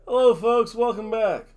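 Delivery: loud, high announcer welcome; show host greeting. Acted. Vlogger welcoming viewers, loud announcer hype. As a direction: boom out the intro like a wrestling host.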